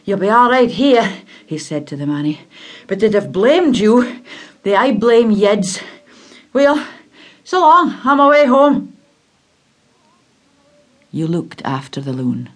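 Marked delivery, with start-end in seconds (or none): 0.0-9.0 raised, lively voice of a stranger saying goodbye; higher and louder, a friendly man taking his leave; kindly stranger. Acted. Yed man speaks to boy.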